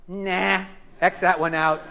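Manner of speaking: ordinary delivery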